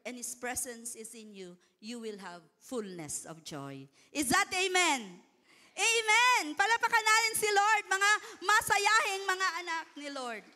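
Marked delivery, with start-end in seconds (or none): 4.1-10.4 loud, high call to clap; full-force preaching, loud and high; rousing preacher shout. Real, not acted. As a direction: whip the congregation into cheering. Preacher rouses the crowd.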